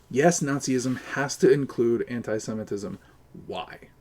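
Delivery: usual speaking voice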